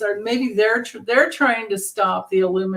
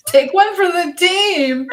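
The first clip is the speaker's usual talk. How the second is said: high, loud laughing plea; laughing while pleading; 9 semitones higher, 7 dB louder than usual. Real, not acted.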